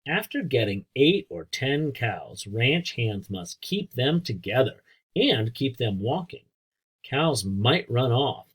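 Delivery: ordinary delivery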